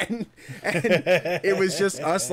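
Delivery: laughing through words. Real, not acted. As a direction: chuckle while telling it. Laughing while telling.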